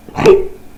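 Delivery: sharp involuntary hiccup; a real hiccup jolting out. Real, not acted. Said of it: "excuse me".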